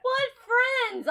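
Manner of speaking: high, incredulous what friends. Real, not acted. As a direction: scoff in disbelief at the idea. Mock outrage at example.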